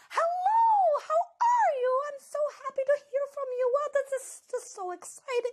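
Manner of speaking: over-the-top high-pitched gush; imitating a very high voice. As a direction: squeak it out way up high, gushing. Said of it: "her pitch is much higher than mine".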